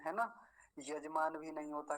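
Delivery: usual speaking voice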